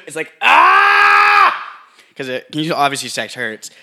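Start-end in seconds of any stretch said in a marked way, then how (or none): 0.4-1.8 sudden high scream at full lungs; yelling. Shown as a demonstration. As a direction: let out a piercing sex yell. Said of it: "can you yell like you were yelling".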